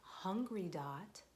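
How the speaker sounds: ordinary delivery, nothing marked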